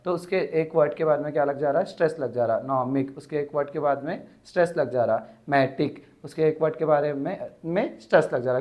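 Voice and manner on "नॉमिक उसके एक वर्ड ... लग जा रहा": usual speaking voice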